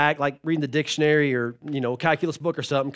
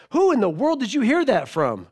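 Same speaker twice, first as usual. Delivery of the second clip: high-pitched shocked demand; shocked parents; 6 semitones higher than usual. Retold or imitated. Parents pulled over questioning their little daughter, shocked. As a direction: act out a parent's scandalized question. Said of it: "just in shock".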